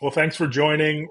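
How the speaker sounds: ordinary talk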